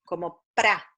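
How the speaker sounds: teacher voicing the cluster syllable pra. Shown as a demonstration. A clear teaching demo of pra. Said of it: "consonante, consonante, vocal".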